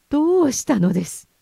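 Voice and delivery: loud, high, upset reproach; reproachful. A narrator's reading. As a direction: demand it in distress. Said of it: "すっかり取り乱した様子でなじった". (取り乱してなじる声)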